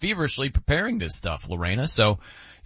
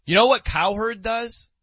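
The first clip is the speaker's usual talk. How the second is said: usual speaking voice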